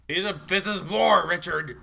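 loud, high battle declaration; mock war cry. Real, not acted. Declares war on game villain.

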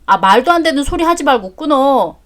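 ordinary delivery

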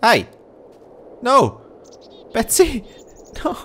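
high, loud panicked yell; yelling at the cow. Real, not acted. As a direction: shout to stop her running off. Yelling at animal escaping.

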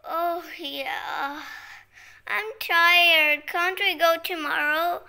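loud, mildly whiny pretend tiredness; play-acted weary complaint; tired sister. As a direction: plead to be left sleeping, loudly.

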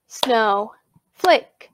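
"snowflake" as two detached beats; split into syllables. Shown as a demonstration. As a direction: pause between "snow" and "flake" in "snowflake". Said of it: "listen very carefully to how I say those".

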